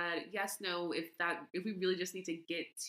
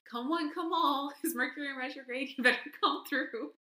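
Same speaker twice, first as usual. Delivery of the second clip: carnival barker; 8 semitones higher, 6 dB louder than usual. Acted. Hammed-up fortune teller call.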